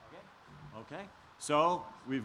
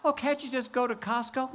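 Cinderella voice; 9 semitones higher than usual. Acted. Cinderella answers her stepsisters.

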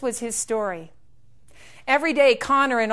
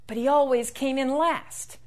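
ordinary delivery, nothing marked

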